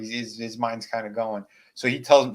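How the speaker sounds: usual speaking voice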